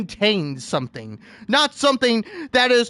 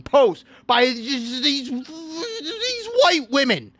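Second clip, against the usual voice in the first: mock sob; 4 semitones higher, slower than usual. Real, not acted. Complaining about TikTok posts, mock crying. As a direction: draw it out like a pretend cry.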